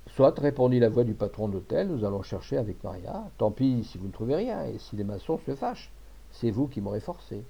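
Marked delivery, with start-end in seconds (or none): none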